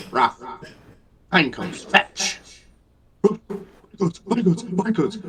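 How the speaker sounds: high, loud, bossy whine; angry whiny voice. Acted. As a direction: snap orders in a whiny cat voice. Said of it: "Astoria has a very angry, whiny voice".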